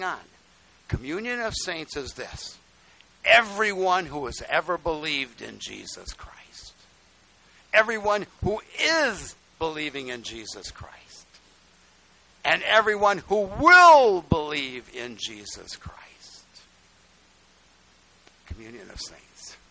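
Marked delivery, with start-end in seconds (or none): 3.2-16.0 slow, swelling from firm to a loud cry; building proclamation. Real, not acted. Preacher to congregation, swelling slow proclamation. 18.5-19.6 whispered, soft and low; hushed whisper. Real, not acted. Preacher to congregation, hushed whispered reverence. Hushed payoff after loud build.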